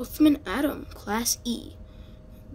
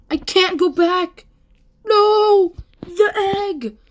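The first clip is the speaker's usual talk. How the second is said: much higher and louder, despairing; dismayed wail; 9 semitones higher, 7 dB louder, slower than usual. Real, not acted. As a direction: cry out in dismay. Dismay at losing egg.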